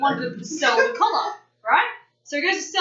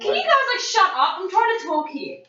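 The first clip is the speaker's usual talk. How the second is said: exasperated; 8 semitones higher than usual. Real, not acted. Storyteller telling interrupting friends to shut up, exasperated. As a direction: cut in over the chatter, pitch jumping up.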